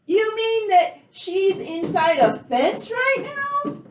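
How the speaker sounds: high, loud squeal of disbelief; shrill-free, just a squeaky outraged What; mock disbelief. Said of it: "And I'm like".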